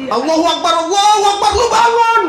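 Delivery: high loud shout of Allahu Akbar; loud playful azan shout; mock call to prayer. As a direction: call out like the mosque waking you. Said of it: "masjid itu teriak-teriak".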